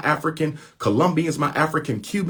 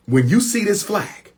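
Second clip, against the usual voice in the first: raised, pressing appeal; emphatic call; 8 semitones higher than usual. Real, not acted. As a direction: lift it up like a rally cry. Calling Afro-Latinos to solidarity.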